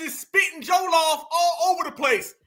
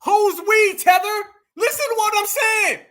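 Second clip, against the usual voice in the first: loud, high outraged shout; fed-up shout of who's we; incredulous shout; 8 semitones higher, 4 dB louder than usual. Real, not acted. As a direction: yell at the screen in disbelief. Ranting at the guest on screen.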